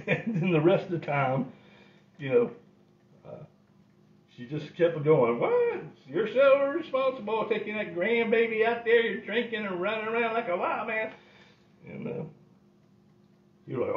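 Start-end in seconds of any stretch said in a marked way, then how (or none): 5.4-11.1 put-on high, scolding old grandma. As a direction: nag like a grandmother catching him out.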